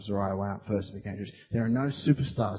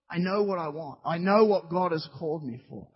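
ordinary delivery

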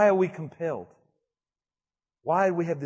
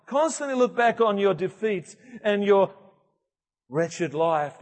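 preaching; 4 semitones higher, 5 dB louder than usual. Acted. Preaching at full force.

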